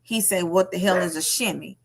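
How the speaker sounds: ordinary talk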